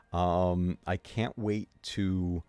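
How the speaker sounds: normal talking voice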